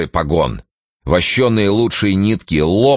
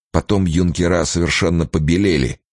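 plain reading voice

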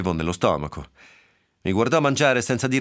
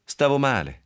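curt; 6 semitones higher than usual. Acted. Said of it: "gli rispose secca".

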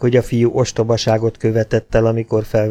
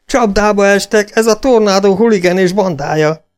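high, loud exclamation; urgent raised voice; shouting; 10 semitones higher, 4 dB louder than usual. A narrator's reading. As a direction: shout the alarm to the gang. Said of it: "ordította Gunnar".

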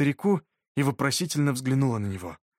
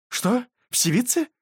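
startled; 11 semitones higher, slower than usual. A narrator's reading. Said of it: "встрепенулась беска".

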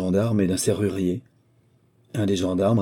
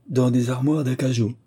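higher, startled echo of his words; anxious surprise; 5 semitones higher than usual. A narrator's reading. Courtesan echoing the agent, surprised and anxious. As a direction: repeat it as if caught out. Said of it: "avec une surprise visiblement mêlée d'angoisse".

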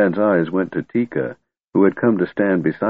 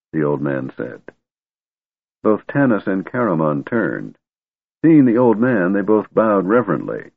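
ordinary delivery, nothing marked

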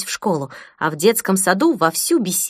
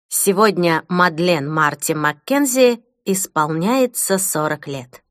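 ordinary delivery

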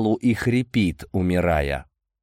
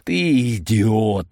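slow, drawn-out angry insult; exasperated anger; much slower than usual. Acted. Amadei rebukes Boris for hiring a killer, exasperated.

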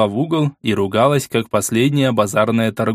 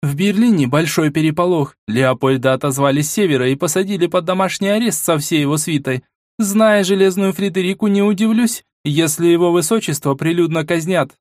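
higher, brisk reporting woman; female voice; 7 semitones higher than usual. Acted. Vasilisa reporting news.

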